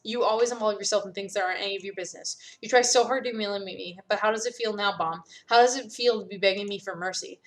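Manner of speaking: ordinary delivery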